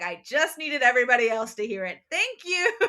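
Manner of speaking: loud mock-triumph, lifting into laughter; smug [name]. [name] having tricked Luke before everyone, gleefully smug.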